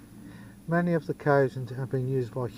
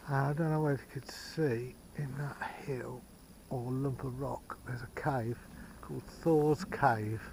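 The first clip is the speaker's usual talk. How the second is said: quiet; 7 dB quieter than usual. Real, not acted. Hushed near hill.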